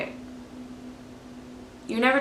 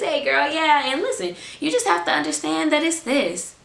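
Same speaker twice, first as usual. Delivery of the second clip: put-on higher, breezy chat with a girlfriend; higher casual friend voice; casual girl talk; 7 semitones higher than usual. Shown as a demonstration. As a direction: keep it light and easy, like talking to your girl. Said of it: "I can talk like this".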